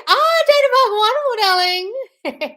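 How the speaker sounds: high, loud, stretched-out wonderful; delighted greeting. Real, not acted. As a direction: gush over the viewer's name.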